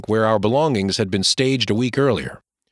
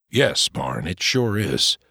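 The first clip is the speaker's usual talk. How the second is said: Pa, agreeing; 6 semitones lower, slower than usual. Acted. Pa voiced.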